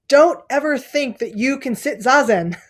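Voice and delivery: mock-fierce, leaning in. Teacher warning a student, mildly fierce. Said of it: "said very fiercely".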